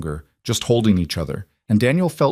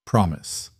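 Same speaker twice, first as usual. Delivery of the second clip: firm reassurance; 2 semitones lower than usual. Acted. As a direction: settle it gently, falling. Father reassures daughter.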